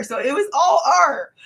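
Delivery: high excited outburst; excited shout. Real, not acted. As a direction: blurt it out thrilled. Excited retelling of wild night.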